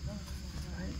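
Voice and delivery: usual speaking voice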